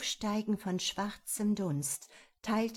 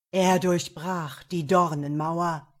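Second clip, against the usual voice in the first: louder menacing demon; underworld god voice; 2 semitones lower, 8 dB louder than usual. A narrator's reading. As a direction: interrogate like a devil. Devil questions.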